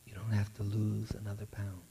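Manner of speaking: quiet, tender reassurance after long silence; the inner voice of grace, soft and sincere; soft voice of God. Acted. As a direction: speak it gently as God's voice.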